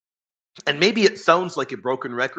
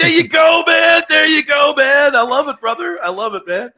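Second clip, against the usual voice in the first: much louder and higher, cheering; excited shout; 13 semitones higher, 11 dB louder than usual. Real, not acted. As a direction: whoop it up for your buddy. Cheering a caller eating Tim Tams.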